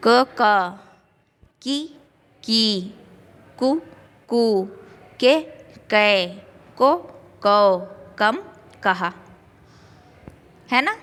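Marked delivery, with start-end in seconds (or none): 0.1-9.1 each syllable said clearly, one at a time; reciting the barakhadi. Shown as a demonstration. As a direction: drill क, की, कु, के, को as a teacher. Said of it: "तो देखो हम किस तरह बनाएंगे".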